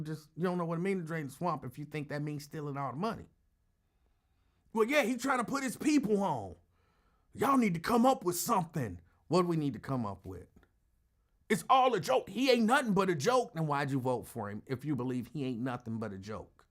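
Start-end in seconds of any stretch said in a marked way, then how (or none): 4.7-9.0 high-pitched mock rebuttal; animated, high impression of an excuse; mock defensive supporter. Mimics supporter arguing back. 11.5-13.5 loud, high supporter impression; high, emphatic mock dismissal; mock dismissive supporter. Mimics supporter dismissing the matter.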